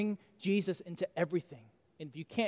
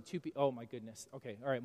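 ordinary talk

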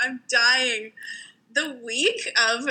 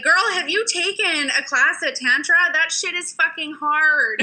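put-on sassy girlfriend voice; 7 semitones higher than usual. Mocking friend's reaction.

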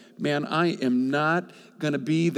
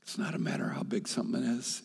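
softer hushed statement; whisper; 7 dB quieter than usual. Real, not acted. Preacher repeating his main truth to the crowd, whispering. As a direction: whisper the point home. Restating key point.

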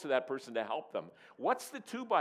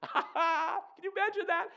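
a real burst of high laughter; laughing; 7 dB louder, slower than usual. Real, not acted. Preacher to the congregation, laughing out loud, high. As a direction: laugh out loud while asking. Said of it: "the crowd is busting up in laughter".

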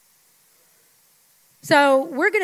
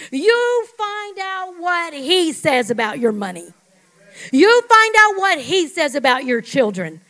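preaching emphasis; 5 semitones higher, 8 dB louder, slower than usual. Acted.

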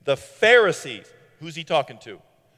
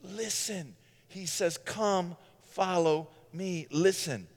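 soft, slow, gentle pleading; a quiet altar-call invitation; tender appeal; 10 dB quieter, slower than usual. Acted. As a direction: hush down and plead with them gently.

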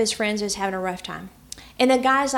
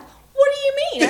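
surprised; 9 dB louder than usual. Retold or imitated. Husband's surprised retort, retold.

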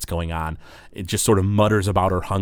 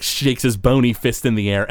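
normal talking voice

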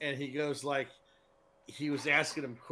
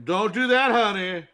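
loud, high-pitched scolding; mock flight attendant; 7 semitones higher, 12 dB louder than usual. Flight attendant warns a passenger off, loud and high. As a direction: call it out as a bossy attendant.

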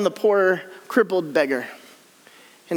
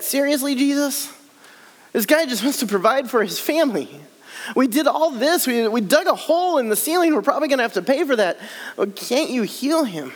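higher-pitched, exasperated complaint; exasperated friend; 5 semitones higher than usual. Acted. Friend on the roof complaining to Jesus, exasperated. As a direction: whine at Jesus in disbelief.

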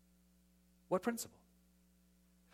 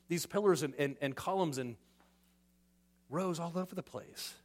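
ordinary delivery

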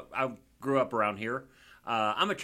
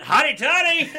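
high, loud laughing cheer of the team chant; gleeful shout; 12 semitones higher, 13 dB louder than usual. Retold or imitated. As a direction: blurt the chant out in delight. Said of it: "I immediately went".

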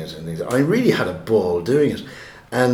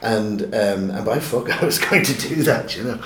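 ordinary talk

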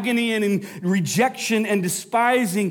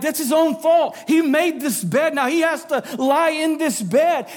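loud and higher, accusing, slowing on the last lines; accusing shout; 5 semitones higher, 3 dB louder than usual. Acted. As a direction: shout the blame at him like a judging crowd. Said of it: "they're like".

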